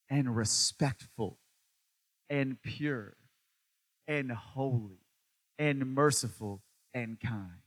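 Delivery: slow scripture reading. Acted. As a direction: savour each word of the verse. Reads scripture slowly.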